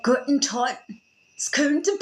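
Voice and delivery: put-on botched German accent gone Scottish; old angry Scottish man. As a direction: try German, land on grumpy old Scotsman. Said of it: "sounded like an old, angry Scottish man".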